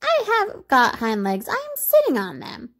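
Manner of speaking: high little-rabbit voice; indignant storybook toy rabbit; crying out in protest. A narrator's reading. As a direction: insist in a small character voice, rising high. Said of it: "cried the little rabbit".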